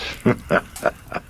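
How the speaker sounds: breathy demonic chuckle. Acted. Demon gloats at the priest, breathy chuckle. Demon laughs after its taunt.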